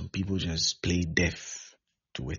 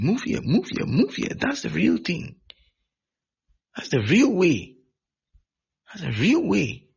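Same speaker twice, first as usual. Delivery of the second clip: emphatic exclaiming; 10 semitones higher, 5 dB louder than usual. Real, not acted. Preacher exclaims over the real way.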